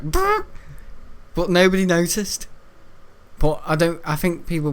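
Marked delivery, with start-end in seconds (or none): 0.0-0.5 high blown raspberry fart noise; imitated fart sound. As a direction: mimic the fart. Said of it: "it was like".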